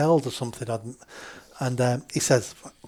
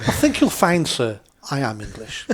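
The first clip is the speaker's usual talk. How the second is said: put-on Hugh Grant accent. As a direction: gently correct him in mock-proper English, nearly laughing. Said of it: "in my best, uh, Hugh Grant accent".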